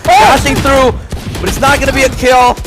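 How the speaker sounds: excited. Real, not acted. Caster hyped during close fight.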